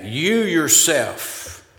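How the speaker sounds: YOU YOURSELF drawn out slowly; emphatic YOU YOURSELF. Shown as a demonstration. Preacher showing the Greek emphasis, slow stress on YOU YOURSELF. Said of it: "that is very emphatic".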